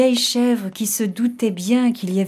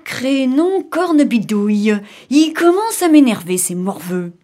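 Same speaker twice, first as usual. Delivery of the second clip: exasperated outburst, dropping at the end; grumbling wolf losing patience, louder; annoyed wolf cry; 3 semitones higher, 4 dB louder than usual. Acted. Wolf curses the kids who refused him, irritated cry. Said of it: "cria le loup".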